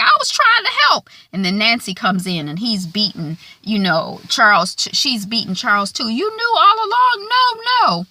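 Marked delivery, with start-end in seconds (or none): 0.0-1.1 very high, loud whiny squeak; mock a pathetic man squealing in defence; hammed-up high whine; Charles quote. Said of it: "Charles is like". 6.1-8.1 very high, loud mock outrage; hammed-up shrieking accusation; Nancy quote. Nancy beating Charles.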